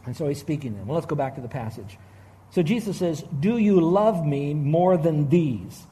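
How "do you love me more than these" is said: very slow, measured quoted question; solemnly paced scripture quote; slow, deliberate quote. Retold or imitated. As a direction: draw out each word of the question.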